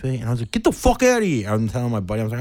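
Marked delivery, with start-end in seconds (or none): 0.5-1.5 high-pitched retold outburst; New York attitude. Fan snapping at the broken-foot guy, high New York outburst. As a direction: dismiss him in mock outrage. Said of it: "this New York attitude at the beginning".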